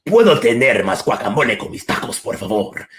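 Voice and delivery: put-on rolled, dramatic Mexican Spanish accent; full Mexican Spanish accent, telenovela style. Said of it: "I would just go straight telenovela mode".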